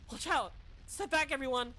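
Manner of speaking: loud alarmed cry; shouting warning. Acted. Warning danger.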